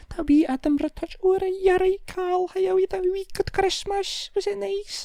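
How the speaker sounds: very high-pitched Scottish lilt; soft Scottish accent. Retold or imitated. Scottish family chatting about Christmas, soft Scottish accent. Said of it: "had that soft".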